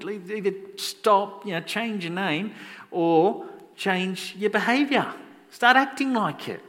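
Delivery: slow, high-pitched exasperated plea; exasperated scolding. Acted. God to those who call themselves Christians, exasperated.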